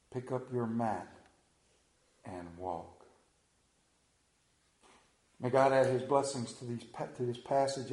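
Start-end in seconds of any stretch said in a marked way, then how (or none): 0.1-3.0 much softer, lower, fading echo of the command; soft repeat. Shown as a demonstration. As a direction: let the words fade as an echo. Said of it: "repeated softly for emphasis".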